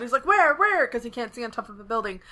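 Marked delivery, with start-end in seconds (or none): 0.3-0.9 frantic. Acted. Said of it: "Tomu's flipping out".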